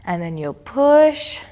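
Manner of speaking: normal talking voice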